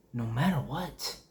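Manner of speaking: ordinary delivery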